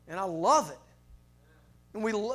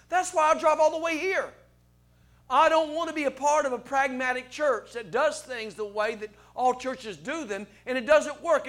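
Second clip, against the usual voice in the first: preaching at full force; 5 semitones higher, 8 dB louder than usual. Acted. Pastor preaching against pragmatic churches.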